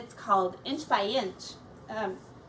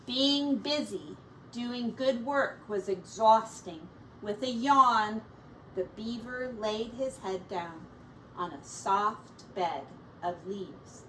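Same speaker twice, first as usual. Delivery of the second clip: story narration. A narrator's reading. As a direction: read it like a picture book for kids.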